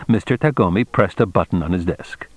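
ordinary delivery

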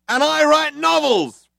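high, slow, proud aside; other cop chiming in. A narrator's reading. Second cop adding his bit, high and slow. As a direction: chime in proudly. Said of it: "chimed in the other cop".

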